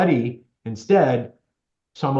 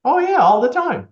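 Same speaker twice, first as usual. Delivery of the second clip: much higher and louder, a mock weary old lady; exasperated old lady; 13 semitones higher, 6 dB louder than usual. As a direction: toss it off like a fed-up old woman. Elderly lady answers.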